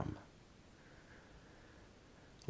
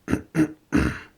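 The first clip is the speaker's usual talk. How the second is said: throat clearing; 6 semitones higher, 3 dB louder than usual. Acted. Preparing to recite a word.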